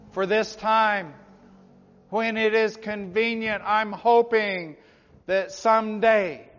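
slow, drawn-out pulpit proclamation; forceful preaching. Real, not acted. Preacher voicing people who put God off, forceful preaching. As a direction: stretch each phrase out for the congregation.

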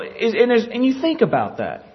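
usual speaking voice

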